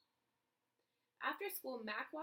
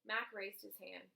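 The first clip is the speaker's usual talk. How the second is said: ordinary delivery, nothing marked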